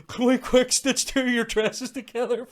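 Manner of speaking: high, loud comic panic; hammed-up frantic order to a partner; mock urgent plea. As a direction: call out urgently, half laughing. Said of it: "I'm sitting there going".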